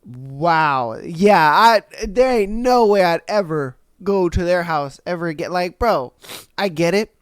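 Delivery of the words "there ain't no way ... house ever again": ordinary talk, nothing marked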